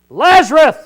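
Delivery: calling a dead man out of the grave at full voice; loud cry. Acted. Jesus at the tomb, shouting to Lazarus. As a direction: shout the name to wake the dead. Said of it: "he yells with that loud voice".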